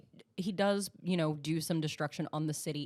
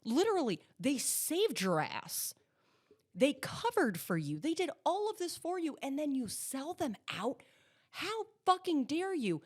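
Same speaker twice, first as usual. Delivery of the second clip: high-swooping outrage, not shouted; mock indignant scolding; 7 semitones higher than usual. Host scolds character for selling out friends, mock indignation. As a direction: scold the traitor, pitch leaping on key words.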